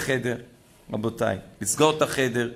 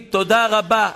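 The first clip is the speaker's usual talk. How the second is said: תודה רבה called out higher and louder; emphatic thanks; 6 semitones higher, 6 dB louder than usual. Shown as a demonstration. Rabbi teaching the congregation to thank God, louder and higher. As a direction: belt out תודה רבה to heaven. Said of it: "תגיד".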